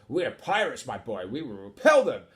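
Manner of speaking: swashbuckler punching the final words; bold pirate captain. Acted. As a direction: declare it as a hearty pirate captain. Voices Captain Eight Piece.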